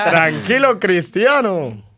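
mock-awed shout; teasing call-out. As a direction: crow it at your friend, dragging the last word. Teasing the friend as egocentric.

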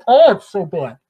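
loud, dismayed groan of a remark; exasperated. Real, not acted. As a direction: cry it out in exasperation. Exasperated reaction to politicians.

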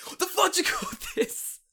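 hammed-up outrage at the dish; Gordon Ramsay rant. Mocking a celebrity chef to a friend, airy high rant. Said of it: "Gordon Ramsay impression".

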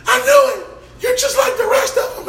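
very high-pitched mimicked screech; screaming. Date screaming after him as he leaves, shrieking. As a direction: screech it shrilly after someone, hammed up. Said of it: "she's still screaming".

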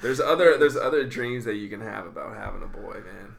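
loud teasing laughter; laughing. Real, not acted. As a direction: laugh while ribbing a friend. Teasing friend while laughing.

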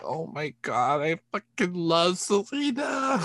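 high, slow, gushing squeal; fangirl squeal. Retold or imitated. Fan retelling her reaction to the show, a high gushing squeal. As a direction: gush like a starstruck fan. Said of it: "And I was like".

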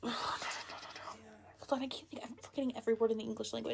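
fast frantic whisper to herself. Real, not acted. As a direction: whisper under breath while thinking. Struggling for a word.

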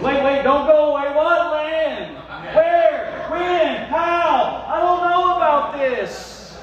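high, loud mock-panicked questioning; bewildered protest. Missionary reacting to a vague calling, loud bewilderment. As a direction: fire off questions in exaggerated bewilderment.

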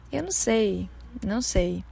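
usual speaking voice

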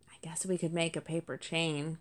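a let-down kit hiding sadness in a whisper; sad whisper. Acted. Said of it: "he couldn't disguise his sadness".

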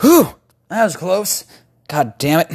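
high relieved gasp of a hero; relieved action hero. Acted. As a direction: puff out relief after a close call. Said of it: "he's like".

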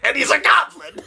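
squeaky high goblin exclamation; laughing goblin squeal. Retold or imitated. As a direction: pitch way up like a little goblin. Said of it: "I'm just immediately like".

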